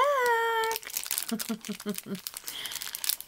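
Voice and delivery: bright high-pitched burst of laughter. Real, not acted. Happy greeting to viewers.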